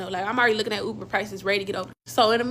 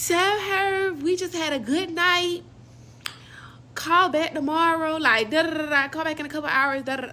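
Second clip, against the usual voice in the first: mocking the other girl; 7 semitones higher than usual. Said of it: "whatever the fuck this bitch was saying".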